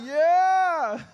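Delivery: high, loud yeah; cheer. Real, not acted. Comic cheering the crowd after the first joke, loud. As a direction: celebrate the first laugh.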